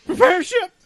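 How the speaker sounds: high commanding villain voice; Spaceballs villain. Retold or imitated. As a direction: order it in a squeaky high voice.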